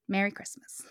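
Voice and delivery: soft whispered "Merry Christmas"; a hushed holiday goodbye; whispered sign-off. Acted. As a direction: breathe it out as a whisper. Story sign-off.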